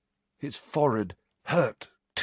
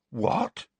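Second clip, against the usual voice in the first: higher, puzzled question; uncomprehending; 7 semitones higher than usual. A narrator's reading. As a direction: ask as if it makes no sense.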